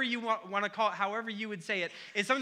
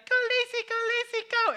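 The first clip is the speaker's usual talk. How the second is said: put-on much higher, tiny toddler voice chanting "Go Lacy"; little kid cheering; 4 dB louder than usual. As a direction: squeak it out like a two-year-old fan. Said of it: "he'd be like".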